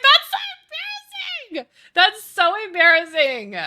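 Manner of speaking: high, loud retold protest; mock mortified outburst. Daughter retelling her protest at mom's remark, mock mortified. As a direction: exclaim it in hammed-up embarrassment.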